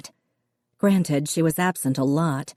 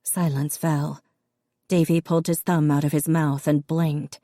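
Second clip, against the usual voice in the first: ordinary delivery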